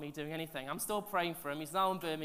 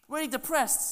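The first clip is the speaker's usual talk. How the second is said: ordinary talk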